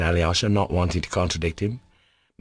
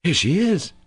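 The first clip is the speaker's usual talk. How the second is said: higher, louder cry; cried out; 10 semitones higher, 4 dB louder than usual. Acted. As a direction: call it out suddenly. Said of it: "cried Alyosha".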